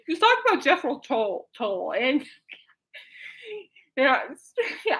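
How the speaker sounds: talking through real giggles; words breaking up into laughter; in stitches. Real, not acted. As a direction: try to speak while cracking up. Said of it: "I'm, like, in stitches".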